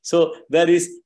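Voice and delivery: ordinary delivery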